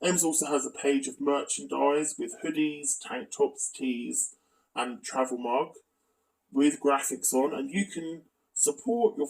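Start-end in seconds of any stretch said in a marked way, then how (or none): none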